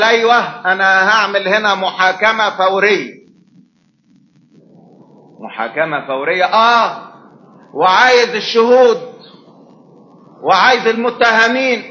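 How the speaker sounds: mildly stern judge opening court; lightly voiced stern judge. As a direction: announce the trial like a stern judge. Voicing the Lord as judge.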